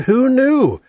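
ordinary delivery

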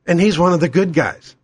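ordinary talk

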